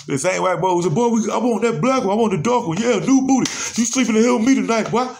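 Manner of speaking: high, loud taunting yell, fast at the end; mock jeering inmate. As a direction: holler the taunt like a cocky inmate.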